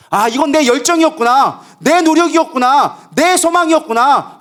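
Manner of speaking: high, loud, drawn-out cry of realisation; fervent preaching. Acted. Preacher voices a depressed believer's realisation to the congregation, fervent preaching.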